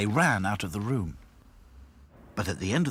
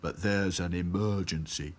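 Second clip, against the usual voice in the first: slow, doleful character voice; glum Sad Sack. A narrator's reading. Sad Sack telling the dolls to stay put, glum. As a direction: drag out the warning dolefully.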